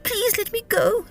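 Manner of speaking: very high, tiny pleading mouse voice. Acted. Mouse begging the lion to spare it, high pleading squeak. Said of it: "it cried".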